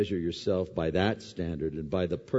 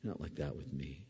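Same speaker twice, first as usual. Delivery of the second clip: quiet self-rebuke; 6 semitones lower, 11 dB quieter than usual. Pretend. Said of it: "I look in myself".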